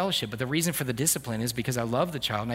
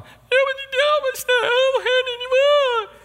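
put-on very high, slow, childish whine with a laugh; little girl complaining; 8 dB louder, slower than usual. Little daughter telling dad what's wrong, high childish whine. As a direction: whine like a small child who feels left out.

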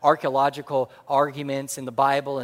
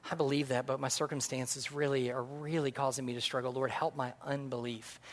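soft, lowered plea; hushed struggling prayer; 3 semitones lower, 10 dB quieter than usual. Acted. Struggling believer asks the Lord for help, hushed prayer. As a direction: pray it quietly, as a confession.

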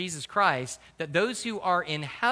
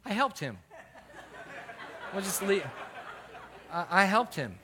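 awkward laugh; 2 semitones higher, 4 dB quieter than usual. Real, not acted. Laughing awkwardly about killing squirrel.

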